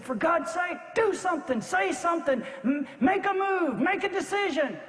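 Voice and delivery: high-pitched, insistent plea; desperate urging. Acted. Brother begs his commander to decide, desperate high-pitched urging. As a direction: urge him to act, pitch held high.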